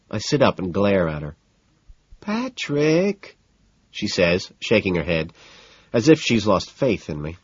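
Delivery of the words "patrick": high, dismayed woman's voice; disappointed woman. A narrator's reading. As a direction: say his name with lost faith. Evelyn's voice.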